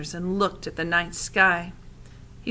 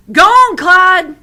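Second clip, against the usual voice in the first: put-on high, loud, slow old woman's call; 11 semitones higher, 15 dB louder, slower than usual. A narrator's reading. Old woman urges the rabbit scarecrow, high loud call. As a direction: holler it at the scarecrow. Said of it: "She clapped her hands".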